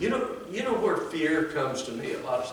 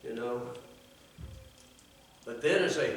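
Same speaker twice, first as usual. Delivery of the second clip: normal talking voice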